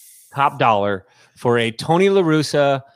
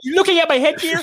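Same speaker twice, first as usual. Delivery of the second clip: high mock voice. Joking in retainer voice.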